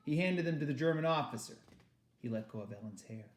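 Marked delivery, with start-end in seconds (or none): none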